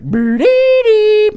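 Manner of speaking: very high, loud, drawn-out creature squeal; Grimace noise. Acted. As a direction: let out a piercing, window-shattering monster call. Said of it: "Grimace is like".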